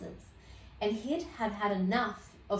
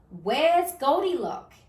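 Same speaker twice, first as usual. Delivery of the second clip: higher, louder, slow demand; demanding Daddy Bear; 6 semitones higher, 6 dB louder than usual. Acted. Daddy Bear finding the wolf, loud slow demand. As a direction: demand it like an angry father bear. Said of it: "demanded Daddy Bear".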